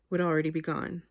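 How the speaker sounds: usual speaking voice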